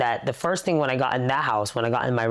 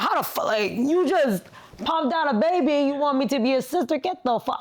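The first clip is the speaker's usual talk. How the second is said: much higher, incredulous mock tirade; retold outraged rant; 12 semitones higher than usual. New older sister challenging parents, high-pitched retold outrage. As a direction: act out indignant disbelief, pitched way up.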